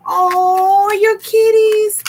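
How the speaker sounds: high, loud, drawn-out squeal; big adoring high-pitched aww; gushing coo. Real, not acted. Gushing over a viewer's cats.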